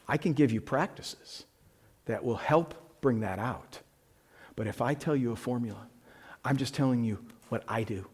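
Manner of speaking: soft, hushed, personal; quiet intimate aside. Acted. Preacher confiding to congregation, soft and hushed. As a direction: lean in and confide.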